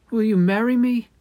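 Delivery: high, loud eager proposal; prince proposing. Acted. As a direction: ask it with hopeful excitement.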